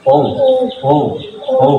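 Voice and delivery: ও said with both lips meeting; lips touching. Shown as a demonstration. Teacher showing how lips meet on ও. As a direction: round the lips so they touch on ও. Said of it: "তাহলে বলার সময়".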